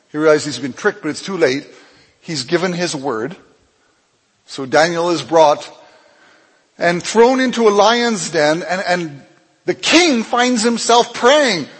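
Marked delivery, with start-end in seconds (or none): none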